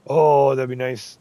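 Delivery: loud pleased oh; light up at the idea; delighted. Real, not acted. Player imagining the finished garden, delighted.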